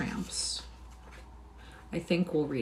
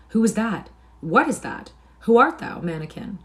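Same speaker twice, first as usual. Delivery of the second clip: loud, astonished questioning; raised voices demanding to know; explosion of inquiries; 5 semitones higher, 8 dB louder than usual. A narrator's reading. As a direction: burst out with each question. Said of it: "a general explosion of inquiries broke out".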